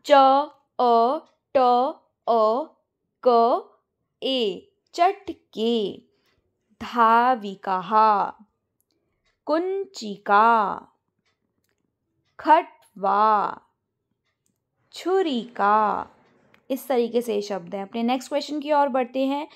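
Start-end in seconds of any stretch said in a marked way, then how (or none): 0.1-5.9 each letter drawn out, one at a time; spelling it out slowly. Shown as a demonstration. Teacher to class, spelling the Sanskrit word letter by letter, slow. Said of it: "मैं आपको answer show कर देती हूँ". 6.8-16.1 each word stretched out slowly; slow answer reading. Shown as a demonstration. Teacher showing answers to students, each word slow and drawn out. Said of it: "मैं आपको answer show कर देती हूँ".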